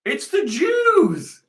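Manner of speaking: high, drawn-out mock wail; mocking imitation. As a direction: parrot them in a high, slow whine. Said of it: "And they're like".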